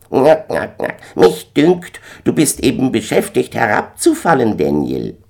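high, loud squawky bird voice; cackle, then squawk your observation; cackling gander. A narrator's reading. Gänserich schnattert und spottet.

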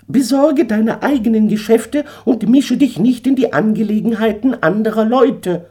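scornful eagle. Acted. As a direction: sneer down at the drunk. Eagle figure held voice.

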